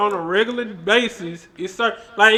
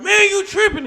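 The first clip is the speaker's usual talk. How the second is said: high, louder mock outburst; raised voice; 14 semitones higher, 6 dB louder than usual. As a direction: act out raising your voice in protest. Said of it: "We might raise our voice".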